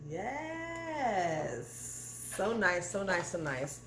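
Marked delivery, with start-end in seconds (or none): 0.1-1.9 long drawn-out, high yes; delighted yes. Real, not acted. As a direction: stretch the word out, swooping high. Delighted by the pretty pan.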